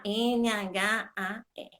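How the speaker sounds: letters of NHAR spelled slowly; each letter spaced apart; slow spelling. Shown as a demonstration.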